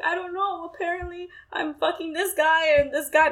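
high, mildly whiny retelling of an upset reply; retold crying upset. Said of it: "be crying in my room".

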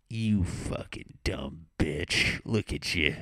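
slow, lightly voiced grumpy old man; hint of a cantankerous old grandpa; cranky grandpa. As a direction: insult the family as a crabby grandpa, drawing it out. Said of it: "I just wanna be a grandpa".

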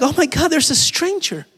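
high-pitched hammed-up panic; mock alarm. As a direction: play the startled wife, voice shooting up. Acts wife alarmed by stranger.

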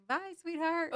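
high, singled-out farewell; phone goodbye. Retold or imitated. Acts out the almost-said goodbye.

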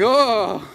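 high, loud drawn-out "oh"; comic cry of alarm; hammed-up horrified howl; mock panicked wail. Pastor's panicked flight, acted.

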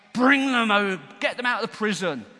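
enraged, booming demand; angry priests. Acted. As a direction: command it as if burning with rage. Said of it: "fired up and angry and".

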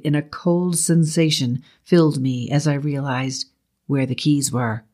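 plain reading voice

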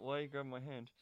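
ordinary talk

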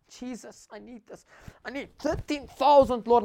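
pleading prayer, a touch of tears; mild tearful plea; retold crying prayer. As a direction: beg the Lord through tears. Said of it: "I start crying".

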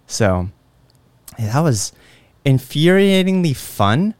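ordinary talk

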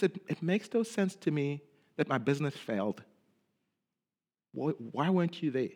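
hushed hurting plea to Jesus; hurt lament prayer. Pretend. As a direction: confess the pain quietly to Jesus. Voicing an honest lament prayer.

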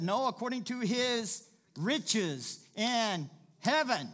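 loud, high, drawn-out proclamation; triumphant preaching shout. Retold or imitated. Preacher correcting the economy version with scripture, triumphant shout. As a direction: belt out the quote word by word.

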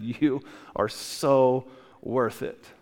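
very slow, each word weighed; slow emphasis. Acted. God telling each listener they are worth it, slowly. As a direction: draw out every word. God's words, slowed for emphasis.

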